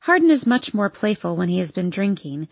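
ordinary delivery, nothing marked

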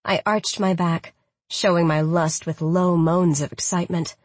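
ordinary delivery

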